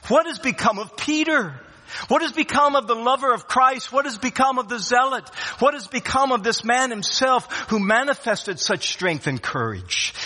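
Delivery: 3 preach it, pressing each question; 1 preaching at full force. Acted. 4 preacher asking the congregation about Peter, ringing preaching.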